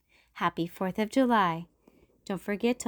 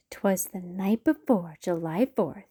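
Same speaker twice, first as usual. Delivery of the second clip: ordinary delivery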